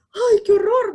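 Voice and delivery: high, loud scandalized gasp of a line; hammed-up shock; mock horror. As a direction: act appalled at someone crying. Mock shocked reaction to tears.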